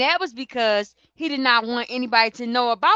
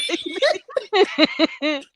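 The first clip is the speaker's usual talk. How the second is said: long helpless laughter; 7 semitones higher than usual. Real, not acted. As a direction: crack up laughing. Laughing at hearing co-host.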